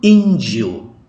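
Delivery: Injo, the I hit loud and long; Portuguese word Injo with the stress on the I. Shown as a demonstration. Teacher models Injo for Indonesian learners, stress clearly on the I. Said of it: "Bacanya demikian".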